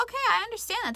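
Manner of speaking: brightly conceding, a bit mocking; mock agreeable.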